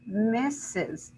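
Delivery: "Misses" split slowly into MISS-IZ; two separate syllables. Shown as a demonstration. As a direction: count out both beats of "Misses". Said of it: "I have two syllables".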